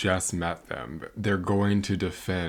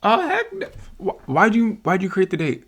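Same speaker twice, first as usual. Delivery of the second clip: very high, loud shriek of disbelief; outraged squeal at the broke date; shocked outrage; 12 semitones higher, 6 dB louder than usual. Real, not acted.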